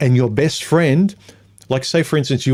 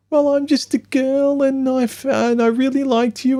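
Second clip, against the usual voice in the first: much higher, sing-song mock girl; mock girly voice. Mocking an ex-girlfriend's excuse in a much higher girly voice. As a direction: parody the ex's coy excuse.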